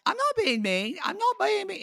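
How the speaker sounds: high, squeaky denial of being mean; mock her in a shrill singsong; mocking a reality star's defense; whiny denial. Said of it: "She's like".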